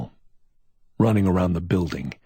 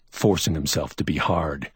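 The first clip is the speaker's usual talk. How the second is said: ordinary delivery, nothing marked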